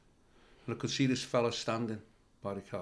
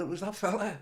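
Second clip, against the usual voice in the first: normal talking voice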